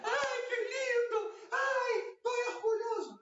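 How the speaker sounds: very high, squealing praise; over-the-top gushing. Shown as a demonstration. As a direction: gush far too much over the puppy. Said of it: "Não, não, vai com calma".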